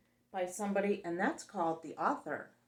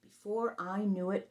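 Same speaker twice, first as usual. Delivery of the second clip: ordinary delivery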